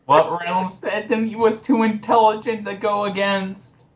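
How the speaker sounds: high, whiny mock voice of the commenter; mocking impression. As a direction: sneer her words back in a silly high voice.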